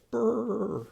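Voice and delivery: high shivery brr, acting cold; shivering brr. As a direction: shiver out a pretend brr for kids. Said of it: "Can you pretend to be cold?".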